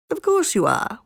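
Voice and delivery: high, mocking fox; snickering. A narrator's reading. Fox mocks the boastful kitten, snickering. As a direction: humor him with a high sneer. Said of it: "snickered the fox".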